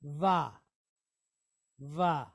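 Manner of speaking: Va said low as v plus open ah; Hebrew syllable demo. Shown as a demonstration. Teacher answers her own question by modeling Va, careful Hebrew pronunciation. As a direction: repeat Va as a model for learners. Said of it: "So that gives us what sound?".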